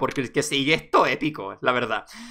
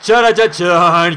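loud, drawn-out "charachachán"; mock dramatic sting; 2 semitones higher, 9 dB louder, much slower than usual. Lawyer mocking the big reveal, loud drawn-out fanfare. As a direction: sing out a slow, loud fanfare.

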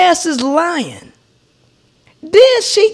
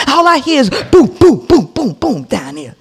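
imitating stomping; 4 semitones lower than usual.

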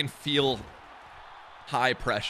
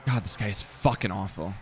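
usual speaking voice

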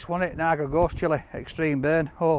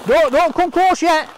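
high, loud excited call to hold back; excited warning; 10 semitones higher, 7 dB louder than usual. Real, not acted. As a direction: keep him back, thrilled and loud. Warning cameraman during unboxing.

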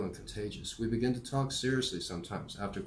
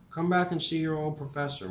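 put-on warm old professor voice; 7 semitones higher, 4 dB louder than usual. Morrie parting words.

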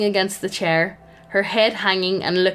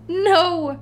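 groaned no; 9 semitones higher than usual. Acted.

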